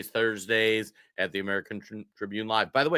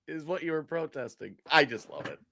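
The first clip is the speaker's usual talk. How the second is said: ordinary talk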